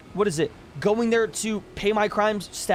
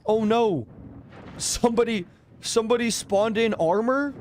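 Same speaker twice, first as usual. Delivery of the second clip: mock panic; 4 semitones higher than usual. Imagined panicked player reaction.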